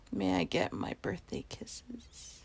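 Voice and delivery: slow, soft whispered request; sweet whisper. Acted. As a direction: coax them gently in a whisper. Asking for kisses, sweet.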